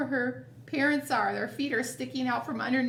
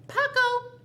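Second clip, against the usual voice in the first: calling out; 12 semitones higher, 5 dB louder than usual. Acted. Father calls Paco.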